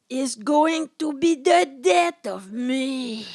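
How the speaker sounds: loud, slow mock-passionate man; hammed-up lover. Voicing Ezra to the audience, hammed-up passion. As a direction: overplay the tortured lover.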